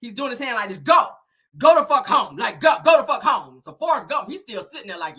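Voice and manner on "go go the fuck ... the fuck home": loud, high, repeated orders; mock-mad hollering; retold angry dismissal. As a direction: order someone out with raised voice. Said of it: "he's still low-key mad".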